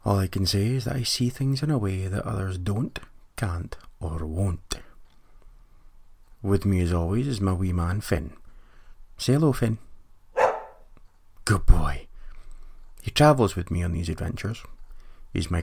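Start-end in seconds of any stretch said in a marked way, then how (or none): 3.3-4.8 slow secretive whisper. Acted. Host confiding his odd outlook to listeners, whispered. Host hinting he sees things differently. 11.4-12.1 whispered praise. Real, not acted. Host praising his dog Finn.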